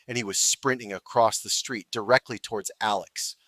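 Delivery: ordinary delivery, nothing marked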